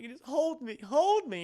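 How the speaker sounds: high-pitched, plaintive begging; acted needy plea. Leia asking Han to hold her, needy. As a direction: plead for comfort, hammed up.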